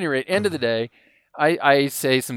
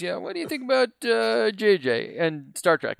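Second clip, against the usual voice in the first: imitating D C; 7 semitones higher than usual. Friend at dinner, a light D C impression asking. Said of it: "He says".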